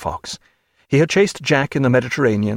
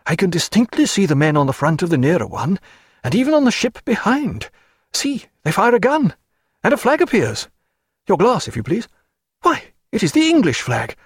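a higher, fluttering clergyman's voice; nervous parson; 8 semitones higher than usual. Acted. Chaplain frets to Stephen about the ships, high and fluttering. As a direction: play the timid chaplain, fussing.

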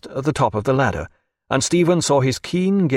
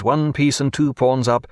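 plain reading voice

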